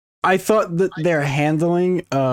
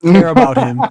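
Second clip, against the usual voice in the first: mock demand; 2 semitones higher, 6 dB louder than usual. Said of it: "the Snyder Cut is just like".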